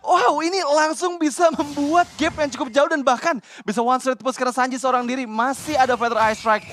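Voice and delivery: high, loud, slowed hype call; excited. Real, not acted. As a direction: ride the excitement of the kill. Co-caster hyped after triple kill.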